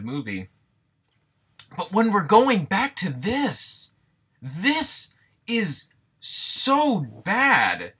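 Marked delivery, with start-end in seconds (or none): 1.8-7.9 loud, high, drawn-out exasperation; exasperated. Real, not acted. Reviewer condemning the movie's stale scares, exasperated. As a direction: stretch out every word in fed-up emphasis.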